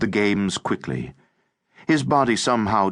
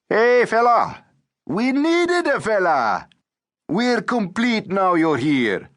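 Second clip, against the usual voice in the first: put-on brash teasing boy; 14 semitones higher, slower than usual. A narrator's reading. Ramazan welcomes the new fella, brash and teasing. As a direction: crow it slowly, pitched right up.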